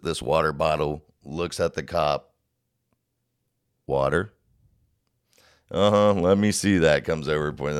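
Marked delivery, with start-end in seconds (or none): 3.9-4.3 questioning lilt, rising sharply; feigned innocence. Retold or imitated. Caught drinker bluffing to a cop, mock-innocent. 5.7-7.0 imitated cop, high and loud; skeptical officer. Officer demanding the drinker's bottle, skeptical. Retold officer doubting the bluff.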